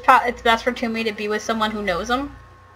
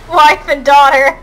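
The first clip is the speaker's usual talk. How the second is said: laughing; 3 semitones higher, 5 dB louder than usual. Real, not acted. Laughing at tragic game plot.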